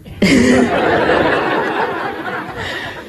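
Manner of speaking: a high breathless laugh; real laugh. Real, not acted. [name] laughs as Swami hands her the job, real laugh. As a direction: laugh it out, half disbelieving.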